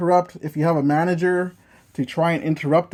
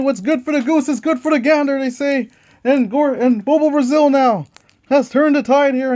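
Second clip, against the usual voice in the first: lively ringside play-by-play; wrestling commentator; 9 semitones higher, 5 dB louder than usual. Acted. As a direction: call the match like a TV announcer. Play-by-play of dice wrestling match.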